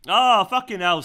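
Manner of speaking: loud, high disbelief; real amazement at the strike; stunned cursing. Real, not acted. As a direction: let the shock burst out. Still stunned by the goal.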